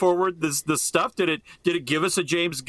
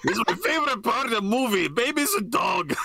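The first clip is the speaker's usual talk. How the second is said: put-on Russian accent; 7 semitones higher than usual. Joins Russian bit.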